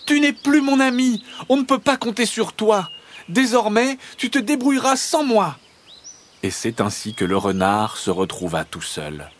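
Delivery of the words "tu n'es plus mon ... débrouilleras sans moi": high-pitched, scolding hedgehog shout; outraged squeaky farewell; indignant crying out. A narrator's reading. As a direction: call back angrily in a high voice. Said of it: "se sauve en criant".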